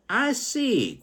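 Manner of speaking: a high, eager "I see"; voiced blind man. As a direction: pitch it up for the third man. Blind man's line in the poem.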